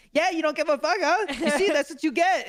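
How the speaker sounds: hammed-up payback jeer; mocking angry taunt. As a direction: driver taunting the man from her flipped car. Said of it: "she's, like".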